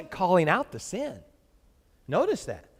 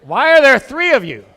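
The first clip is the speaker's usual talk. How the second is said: louder, higher mock drunk squint; mock drunk question; 5 semitones higher, 11 dB louder than usual. Pastor imitating a drunk churchgoer, hammed-up and loud. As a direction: play the confused drunk for laughs. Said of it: "he would look at you and".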